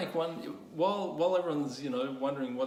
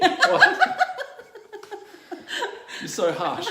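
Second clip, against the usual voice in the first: shrieked high and loud what; outraged squeal of disbelief; mock outrage; 11 dB louder, slower than usual.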